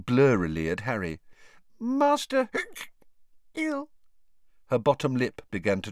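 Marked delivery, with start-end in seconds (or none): 1.8-3.9 high squeaky elf, hiccuping through tipsy words; slurred and slow, breaking off for hiccups; drunk hiccuping elf. A narrator's reading. Drunken Winky answers Harry about her master, hiccuping.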